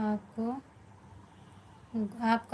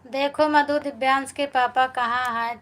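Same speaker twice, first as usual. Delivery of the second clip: loud, high call to Madhu; 3 semitones higher, 6 dB louder than usual. Real, not acted. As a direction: call out to get Madhu's attention. Calling out to Madhu on stream.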